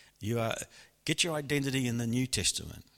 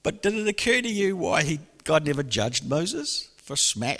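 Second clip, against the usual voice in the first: ordinary talk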